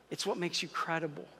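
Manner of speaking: ordinary talk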